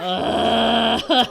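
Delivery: loud startled ugh; startled groan. Acted. Hana startled by her friend, loud groan.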